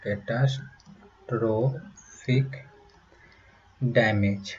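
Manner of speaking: catastrophic stretched very slowly, syllable by syllable; slow drawn out. Shown as a demonstration. Teacher spelling out a hard word slowly. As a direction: draw out catastrophic for the class. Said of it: "catastrophic damage के लिए".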